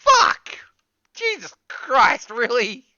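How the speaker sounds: loud, high startled curse, then slow disbelief; shocked yell. Real, not acted. Player startled by what the scene reveals, yelling. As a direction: react as if jolted.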